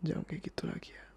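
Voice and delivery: very soft, intimate whisper, fading away; whispered plea. Acted. Boyfriend asking partner not to do it again, whispering tenderly. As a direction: plead gently, almost without voice.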